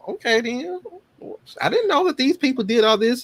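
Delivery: high-pitched astonishment; high amazed realization. Retold or imitated. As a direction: lift it up in wonder. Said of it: "you know".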